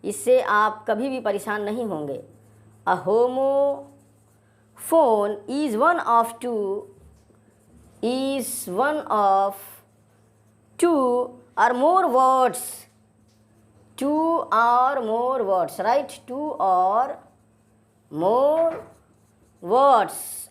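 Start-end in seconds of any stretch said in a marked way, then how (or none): 2.9-15.8 very slow, word by word, for notes; slow dictation. Shown as a demonstration. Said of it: "आप भी notes ज़रूर बनाएं". 16.3-20.5 slow dictation. Shown as a demonstration. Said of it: "आप भी notes ज़रूर बनाएं".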